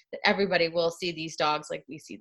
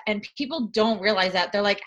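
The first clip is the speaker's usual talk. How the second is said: ordinary talk, nothing marked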